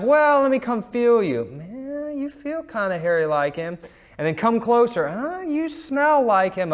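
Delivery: high, put-on voice of a doubtful old man; doubtful old Isaac. Old father feeling and sniffing his son, hammed-up doubt. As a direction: pitch it up like a puzzled old father.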